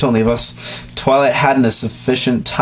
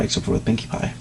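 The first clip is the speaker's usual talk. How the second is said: whispering; 3 semitones lower, 9 dB quieter, faster than usual. Real, not acted. Whispered aside.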